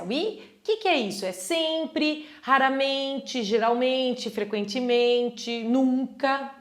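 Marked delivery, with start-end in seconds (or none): 1.3-6.5 each syllable laid out slowly; slow teaching read. Shown as a demonstration. Teacher reading out raramente slowly to the class. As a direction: spell the word out in sound for students. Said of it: "listing adverbs slowly for learners".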